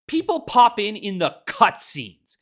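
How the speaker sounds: ordinary delivery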